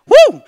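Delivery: loud high-pitched whoop; sudden exultant "woo"; joyful whoop. Real, not acted. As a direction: let out a shout of celebration. Whoop amid joyful psalm.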